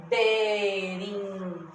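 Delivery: be-rin-je-la in slow, separate beats; split into syllables. Shown as a demonstration. Teacher showing four mouth openings in berinjela, slowly segmented. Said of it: "Quatro vezes que eu abri a minha boca pra pronunciar".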